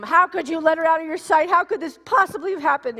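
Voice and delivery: high, loud frantic blame; ripping into mom. Retold or imitated. Mother at the restaurant, frantic loud blame. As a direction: tear into your mother, panicked. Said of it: "I'm just ripping my mom".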